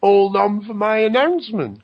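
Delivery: high mocking quote of him; a hint of a mock announcement; mocking David Icke. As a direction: lightly mimic his pompous promise. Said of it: "telling them, like".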